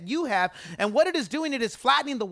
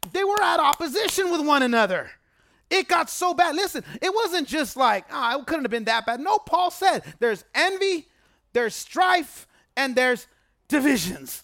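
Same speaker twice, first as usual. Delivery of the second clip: rising preaching fervor; 4 semitones higher than usual. Acted. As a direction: preach it to a climax. Preacher climbs to climax.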